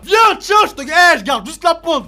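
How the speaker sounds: high, loud shouting challenge; défi crié. Real, not acted. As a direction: shout a dare at the chili. Défie le piment en criant. (crie un défi au piment)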